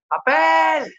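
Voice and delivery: loud, high yell for paper; shouting for paper. Retold or imitated. Man banging the stall door calls for paper, loud high shout. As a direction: bellow it through the stall door. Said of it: "y gritó".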